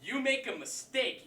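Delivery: ordinary delivery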